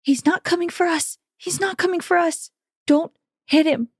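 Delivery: high urgent whisper-yell; hiss-shout the warning to the group. Pretend. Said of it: "kinda whisper shout".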